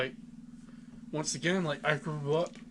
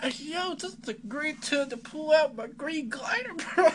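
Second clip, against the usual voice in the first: much higher, slow goofy villain voice, bro-ish; mock Green Goblin; 13 semitones higher, 4 dB louder than usual. Teasing his co-host by playing the Goblin, a high slow goofy drawl. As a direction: ham it up as a silly supervillain showing off his glider.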